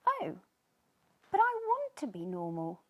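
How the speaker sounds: mild sad plea; a touch of sadness, lightly voiced; sadly. Sneezy telling the fish, mildly sad. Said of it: "said Sneezy sadly".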